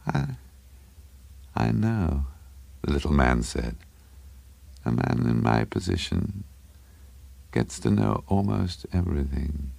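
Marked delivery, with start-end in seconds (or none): 0.1-2.3 high, soft, hesitant voice; confiding little man. A narrator's reading. Little man confiding in Craven, soft and halting. 4.9-9.7 soft, halting self-importance; confiding little man. A narrator's reading. Little man boasting to Craven, soft and confiding.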